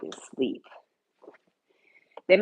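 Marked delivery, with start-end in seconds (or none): 0.0-0.6 hushed whisper so he sleeps on; real whisper. Real, not acted. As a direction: breathe it out, no voice. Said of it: "'cause Fun Please is asleep".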